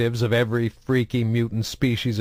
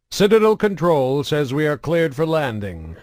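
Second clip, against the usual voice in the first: intercom voice; 5 semitones higher, 4 dB louder than usual. Acted. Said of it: "came the helmsman's voice over the shipboard intercom".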